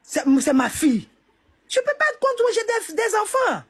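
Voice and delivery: crying, pitch high and strained; tearful. Real, not acted. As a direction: plead through tears. Tearful plea defending the widow.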